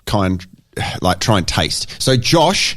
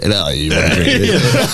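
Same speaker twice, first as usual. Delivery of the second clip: put-on drunk voice; 6 semitones lower than usual. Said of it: "you'll be like".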